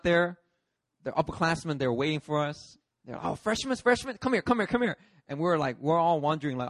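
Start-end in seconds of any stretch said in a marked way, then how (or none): 3.2-5.0 high, hurried beckoning; higher-pitched calls in quick repeats; calling out. Retold or imitated. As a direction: wave the newcomers over eagerly. Upperclassmen calling freshmen over.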